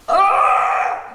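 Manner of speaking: a sudden high loud cry of pain; imitated pained scream. Son ringing from the tattoo chair, pained scream. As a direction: howl like the tattoo is killing you. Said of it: "screaming again".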